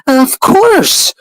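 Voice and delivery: squealing high camp impression. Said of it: "The gays are gonna be like".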